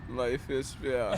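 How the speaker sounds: slow, low Russian accent. Shown as a demonstration. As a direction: deliver it like a Russian. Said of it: "say it".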